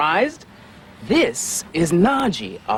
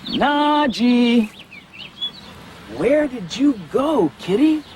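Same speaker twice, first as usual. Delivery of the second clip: calling a lost pet; 8 semitones higher, slower than usual. Pretend. Host calls hiding tiger like pet.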